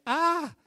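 high mock gasp of dismay. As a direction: let out a sharp, high ah of shock. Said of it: "And you're just like".

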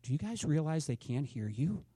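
a quiet aside from the daughter-in-law; quiet remark. Retold or imitated. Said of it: "she just quietly said".

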